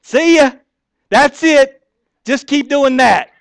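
loud, high cheerful goodbye; cheery send-off. Retold or imitated. Mama bird cheerfully calling after the chick she just pushed out, loud. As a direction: wave the little bird off happily. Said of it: "I'm like".

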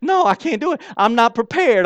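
high, pleading little-bird voice; whiny baby bird. Retold or imitated. Preacher voicing a baby bird begging not to be pushed out, high and whiny. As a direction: whine that you aren't ready. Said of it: "that baby bird goes".

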